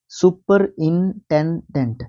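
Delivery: Superintendent spelled out slowly in syllables; teacher stretching superintendent for dictation. Shown as a demonstration. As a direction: break superintendent into its parts slowly for students. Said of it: "सुप्रीन इकट्ठा लिख लीजिए".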